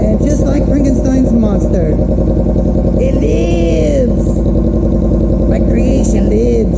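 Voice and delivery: high, theatrical mad scientist cry. Pretend. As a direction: proclaim it like Dr. Frankenstein. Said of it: "had to have a little bit of a sense of humor".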